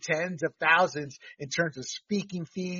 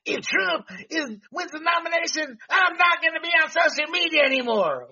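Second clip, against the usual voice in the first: high mocking hysterical shout; Bill Mitchell; 11 semitones higher, 4 dB louder than usual. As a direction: shriek it like a tantrum. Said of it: "He's become a true moron in Bill Mitchell".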